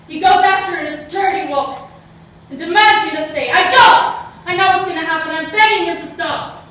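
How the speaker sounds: frantic loud telling of the attack; panicked retelling. Acted. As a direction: tell it as the nightmare closes in. Reliving nightmare, rising panic.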